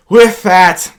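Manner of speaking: ordinary talk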